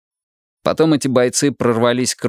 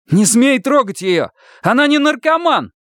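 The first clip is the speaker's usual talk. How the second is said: frantic shout; slower than usual. Acted. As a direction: scream at him to stop. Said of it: "крикнул оглохший Илья".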